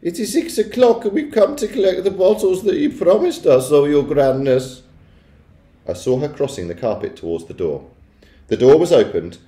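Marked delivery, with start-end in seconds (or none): 0.0-4.8 high, sustained, deferential witch voice; meek. A narrator's reading. Ancient witches asking for promised bottles, meekly. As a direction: petition in a high, timid singsong. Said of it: "said a meek voice".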